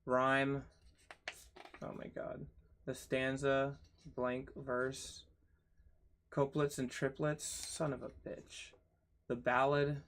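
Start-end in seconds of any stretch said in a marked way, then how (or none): 1.8-2.5 breathy whispered oh my god under the breath; a hopeless little whisper; exasperated whisper. Real, not acted. Dismayed at more chapters. 7.4-8.7 muttered curse in a whisper; a hushed, defeated curse. Real, not acted. Cursing at yet more topics.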